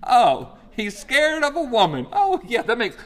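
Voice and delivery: high, loud, giddy onlookers; excited gossiping angels. Pretend. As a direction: cheer like excited spectators. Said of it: "angels watching Elijah like".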